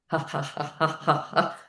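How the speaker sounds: soft, evenly spaced spoken ha's; light mechanical laugh to warm up; shaking ha-ha. Shown as a demonstration. Teacher shows shaking of whole body, soft deliberate ha's. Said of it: "shaking of the whole of the body".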